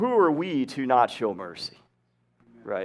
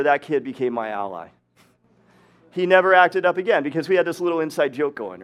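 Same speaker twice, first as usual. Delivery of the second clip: ordinary talk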